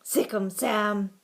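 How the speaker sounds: loud crowd-style urging; egging on. A narrator's reading. Boys setting the dog on the gopher, loud urging. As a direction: call it out to set the dog on. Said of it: "everyone seemed to be saying at once".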